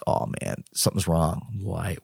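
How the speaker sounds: soft, half-whispered alarm; worried inner thought. Retold or imitated. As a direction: murmur it like dread creeping in. Panicked inner thought over the text.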